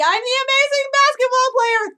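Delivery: hammed-up boastful baller; mock braggart. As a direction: puff up like a cocky star. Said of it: "like, doing the".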